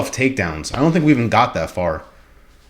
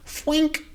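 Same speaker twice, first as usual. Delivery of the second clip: punch sound effect; 5 dB quieter than usual. Retold or imitated. Imitating a punch landing.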